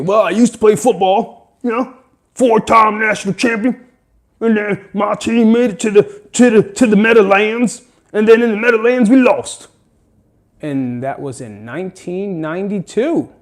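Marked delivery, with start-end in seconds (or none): 0.0-9.7 higher, louder put-on jock bragging about old glory; loud, proud has-been athlete voice; washed-up jock voice. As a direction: brag like a former football star stuck in the past. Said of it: "this made-up fucking guy who sounds like Val Kilmer".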